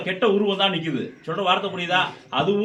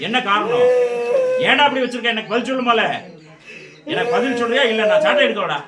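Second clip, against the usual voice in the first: raised, higher, forceful questioning; stern demand; 4 semitones higher, 5 dB louder than usual. Real, not acted. Medium threatens the whip unless answered, stern loud demand. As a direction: press hard for an answer.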